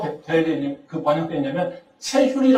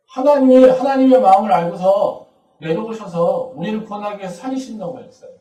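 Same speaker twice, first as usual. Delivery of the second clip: usual speaking voice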